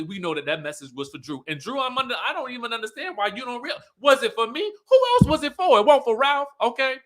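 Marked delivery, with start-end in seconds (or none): 4.0-7.0 Drew's doubt mocked, then a much higher, louder answer; loud mocking. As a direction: shout the obvious answer back at her. Mocking Drew, then answering loudly.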